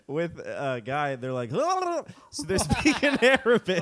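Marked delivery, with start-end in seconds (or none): 1.6-2.1 high, rolling mock gibberish; imitated foreign chatter. Quoting a guy speaking Arabic at the bodega, high mock gibberish. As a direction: trill out high nonsense syllables. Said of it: "I'm just quoting him".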